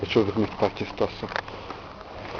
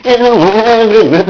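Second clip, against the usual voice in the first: squeaky refusing voice; 10 semitones higher, 12 dB louder than usual. Pretend. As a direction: shriek it, playing a stubborn girl. Refusing to open the door.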